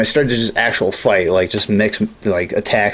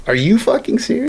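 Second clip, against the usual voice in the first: high-pitched exasperated disbelief; mock incredulous; 9 semitones higher than usual. Player retelling being stuck underwater by a stalker, incredulous. Retold disbelief at a stubborn opponent.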